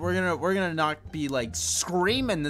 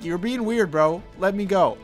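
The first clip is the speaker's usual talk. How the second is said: louder, higher mock objection; acted protest; 6 semitones higher, 8 dB louder than usual. Player to the creature that grabbed him, raised mock protest. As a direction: push it out like a playful protest.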